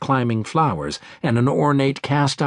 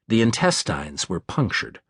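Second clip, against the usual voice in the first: usual speaking voice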